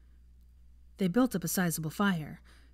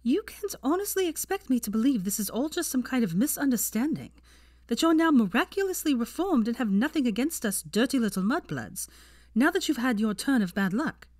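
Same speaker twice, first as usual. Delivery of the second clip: scoffing; 6 semitones higher, 3 dB louder than usual. A narrator's reading. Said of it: "Hermione scoffed".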